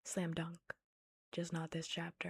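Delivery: usual speaking voice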